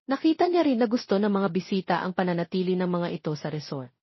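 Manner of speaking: usual speaking voice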